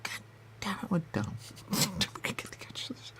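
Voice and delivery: hushed whisper under the breath; a real whisper. Real, not acted. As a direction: whisper it to yourself. Muttering to themselves.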